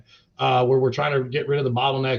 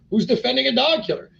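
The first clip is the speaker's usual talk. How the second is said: high, loud mock outrage at the critics; indignant retort; 10 semitones higher, 5 dB louder than usual. Replying to political callers about the mayor, high indignant outburst. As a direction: throw it back at them, indignant.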